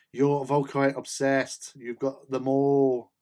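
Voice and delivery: slow playful ribbing; mock accusing. Real, not acted. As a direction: drag it out to rib a friend. Teasing a viewer about Volkites.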